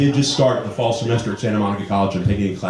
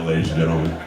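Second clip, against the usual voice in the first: ordinary talk, nothing marked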